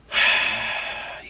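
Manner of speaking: long breathy exhale; heavy sigh. Real, not acted. Speaker closing a downbeat confession, weary sigh.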